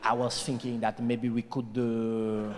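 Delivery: put-on low, seductive French accent; a hammed-up French lover. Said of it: "They've got that thing".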